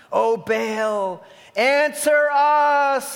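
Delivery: high, loud, slow plea; wailing cry to Baal. Acted. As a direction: call out to the silent god. Prophets crying out to Baal.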